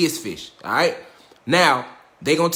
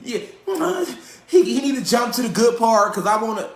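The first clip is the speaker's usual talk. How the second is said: mocking impatient viewer; 5 semitones higher than usual. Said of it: "Don't try to get on here and".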